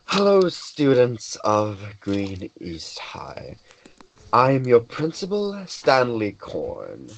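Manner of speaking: slow school principal addressing the students; drawn-out, formal announcement to a crowd; lightly acted principal's welcome speech; Stanley Korn. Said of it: "holds out a small orb and says".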